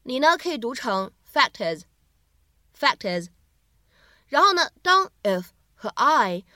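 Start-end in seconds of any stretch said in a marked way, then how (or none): 1.3-3.3 "fact is" linked into one word, the t running into is. Shown as a demonstration. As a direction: slowly run "fact is" together as "fac-tis". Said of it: "可以自然的连读一下".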